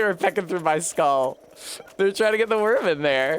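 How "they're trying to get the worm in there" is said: put-on lightly sing-song anime boy lilt; a light Momiji-style lilt, bouncing along; a hint of a cheerful anime boy; Fruits Basket lilt. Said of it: "I said that with a sort of Toru lilt".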